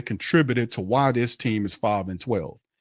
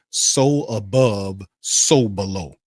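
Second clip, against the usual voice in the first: slow solemn quote; 3 semitones lower, slower than usual. Acted. Quoting scripture slowly.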